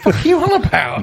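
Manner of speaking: high, fast, laughing; squealing laugh at the dinosaur kid's claim; laughing disbelief. Retold or imitated. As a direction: laugh it out in mock outrage. Mock disbelief at a kid.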